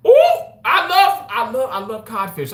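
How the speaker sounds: sudden high, loud squeal; excited outburst. Real, not acted. Streamer remembers a favourite fish, excited squeal. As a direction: burst out with craving.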